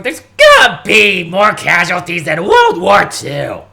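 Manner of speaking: loud gruff doomsday rant; hammed-up Bernie Sanders, loud; Bernie Sanders impression. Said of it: "when you have Bernie Sanders going".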